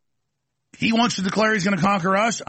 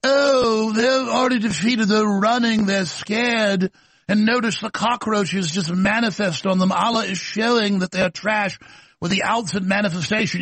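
mock British accent; 4 semitones higher than usual. Host mocking the imam's words in a put-on British accent. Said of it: "listen to this guy in his British accent".

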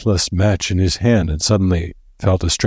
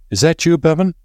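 high-pitched anxious caller; Gold's voice; 11 semitones higher, 3 dB louder than usual. A narrator's reading. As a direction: pitch it well up, calling a question. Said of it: "It was the voice of Gold".

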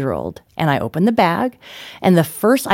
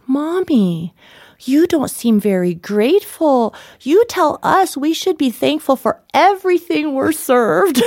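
lightly voiced five-year-old, higher; child's voice; 7 semitones higher than usual. Her five-year-old son chiding mommy, a hint of a child. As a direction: play a small boy scolding gently, a bit high. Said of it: "Ethan, who's five, is like".

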